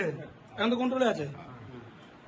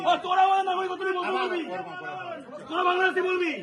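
high-pitched angry challenge; real heated protest; indignant; 5 semitones higher, 3 dB quieter, faster than usual. Real, not acted. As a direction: hurl it over the uproar as an accusation. Indignant about citizenship being questioned.

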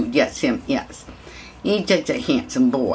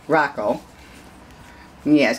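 ordinary talk

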